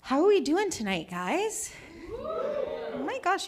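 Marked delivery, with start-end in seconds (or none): 2.2-3.5 gushing. Real, not acted. Gushing at front row.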